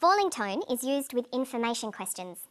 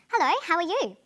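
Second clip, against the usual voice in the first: bright happy greeting, higher and louder; 6 semitones higher, 5 dB louder than usual. Shown as a demonstration. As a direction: greet someone as if delighted to see them. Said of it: "Someone can sound happy".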